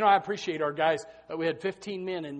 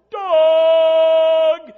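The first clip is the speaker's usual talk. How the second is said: long, loud, high holler of a name; imitating Dad calling across the woods; father's yell; 9 semitones higher, 14 dB louder than usual. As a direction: bellow the name like a foghorn. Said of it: "he would yell".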